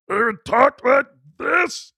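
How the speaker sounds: high, loud, garbled half-tongue voice; slow, loud, tongueless garble; half-tongued man. Retold or imitated. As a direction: talk as if half your tongue is missing. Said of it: "He had, like, half a tongue".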